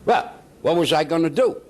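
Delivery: ordinary talk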